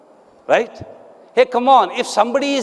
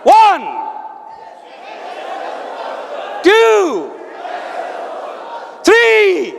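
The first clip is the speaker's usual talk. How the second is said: shouted count; 9 semitones higher, much slower than usual. Real, not acted. Counting the audience up and down.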